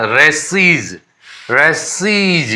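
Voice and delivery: रेसीज with hissing s and buzzing final z; buzzing sibilant. Shown as a demonstration. Teacher demonstrates sibilant sounds, रेसीज hissed and buzzed. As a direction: draw out रेसीज so its sibilants buzz, longer the second time. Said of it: "भिनभिनाने जैसी आवाज़ आ रही है".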